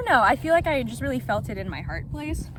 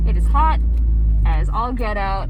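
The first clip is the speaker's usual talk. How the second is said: emphatic loud; 11 dB louder than usual. Real, not acted. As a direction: really lean into it. Emphatic about the heat.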